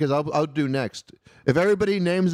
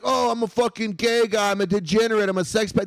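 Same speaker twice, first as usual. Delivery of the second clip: lightly voiced mock confession, pitched up; mocking other comics; 6 semitones higher than usual. Host parodying other comedians' self-labels, lightly mocking voice. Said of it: "why is everybody else like".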